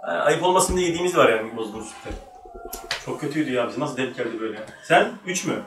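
normal talking voice

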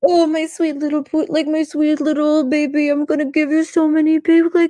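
put-on high, gushing baby-talk voice, like cooing at a puppy; baby talk to a dog. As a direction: coo it as if to a beloved pet. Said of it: "it sounds like he's talking to a dog".

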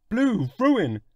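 high alarmed outcry; cry out. Acted. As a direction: blurt it out in dismay. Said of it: "cried the bacteriologist".

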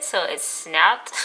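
talking with finger at lip; finger pulling lip aside. Real, not acted. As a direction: lip held open by finger. Seen 4: Finger at lip showing braces from 1.8 s while laughing.

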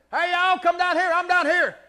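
very loud, high holler; shouting from afar. Acted. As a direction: bellow to folks down the road. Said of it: "He don't have to shout it out".

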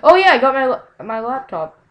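sudden loud, high burst of news; excited announcement. Real, not acted. Gamer remembering news for viewers, excited announcement. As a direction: blurt it out as it comes back to you.

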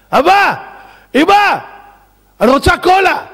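put-on high, loud childlike demand; princess calling out. Cured princess calling to her parents, high mock child's voice. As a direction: call out high like a child wanting a treat.

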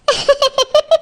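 light, rising giggle; giddy little laugh; happy giggle. Acted. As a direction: giggle with delight to herself. Bride happy thinking of wedding.

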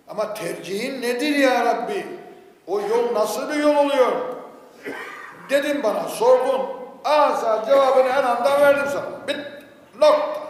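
loud, high, emphatic sermon delivery; impassioned preaching. Acted. Preacher retelling his question to God, impassioned and loud. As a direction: preach it at full force, driving to a final stop.

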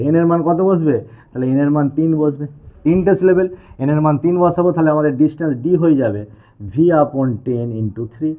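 usual speaking voice

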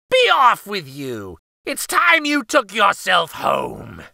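loud, slow furious shout; angry witch. Acted. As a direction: bellow her out the door. Baba Yaga drives her out.

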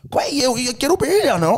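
high, drawn-out mock taunt; goading retort. Manager poking an angry late customer, goading.

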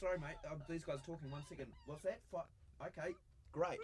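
turned away from the mic, talking aside. Real, not acted. Host talks away from microphone.

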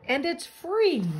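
high, drawn-out last word; jolly bug. A narrator's reading. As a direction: stretch it out as a merry selling point. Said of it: "laughed the bug".

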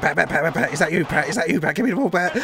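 loud, urgent calls to a player; calling out. Real, not acted. Fan loudly urging player Pat, calling out. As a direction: call Pat over for the ball.

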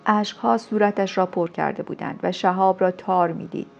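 plain reading voice

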